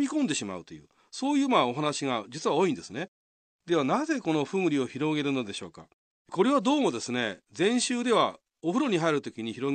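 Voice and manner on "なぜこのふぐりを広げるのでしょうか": ordinary talk, nothing marked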